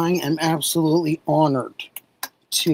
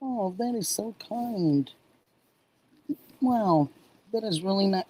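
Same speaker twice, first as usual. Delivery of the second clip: touched; 3 semitones higher, 6 dB quieter than usual. Real, not acted. Touched by a viewer's comment.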